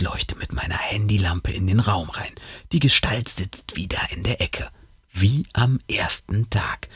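hushed whisper in the dark. A narrator's reading. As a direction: whisper so nothing in the room hears you. Creeping into dark room.

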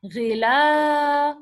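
ghilafun, slowly, on a long drawn-out "laa"; long a sound. Shown as a demonstration. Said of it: "plus a".

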